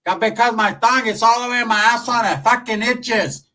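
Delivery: high, loud whiny nag; nagging aunt. Retold or imitated. Comic aunt nagging Rafa to hurry up. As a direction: complain it like a fed-up aunt. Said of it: "My tia's bitching and complaining".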